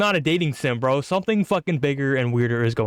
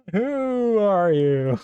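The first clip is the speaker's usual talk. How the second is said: slow dramatic question; 5 semitones higher, much slower than usual. Acted. Voicing the game character.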